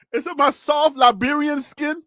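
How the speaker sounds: high, playful pleading; mock indignation. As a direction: ask it as a mock-wounded appeal.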